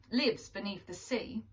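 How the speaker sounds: usual speaking voice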